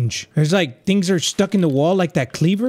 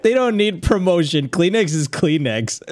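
ordinary delivery

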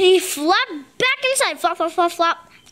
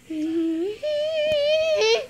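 high singsong laugh; 10 semitones higher, 3 dB quieter than usual. Real, not acted. Laughs at the story.

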